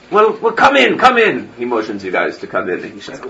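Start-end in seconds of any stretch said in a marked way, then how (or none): 0.1-1.5 a hint of an old priest, higher; hurrying the visitors inside; old priest welcoming. GM voices priest inviting in.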